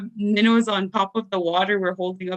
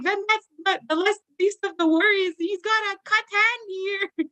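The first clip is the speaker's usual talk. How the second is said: exasperated granny; 13 semitones higher than usual. Retold or imitated. Granny scolds worried kids.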